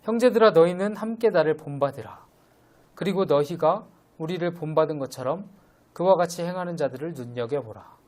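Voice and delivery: solemn public reading of the Bible; scripture reading. Acted. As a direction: proclaim the verses to the congregation. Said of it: "제가 읽어드리겠습니다".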